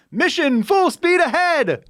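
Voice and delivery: high, hammed-up cheerful cry, slowed; mock gung-ho Walker. Mocking Walker to cohost, cheerful high cry. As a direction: send them off like a cartoon cheerleader. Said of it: "she's like".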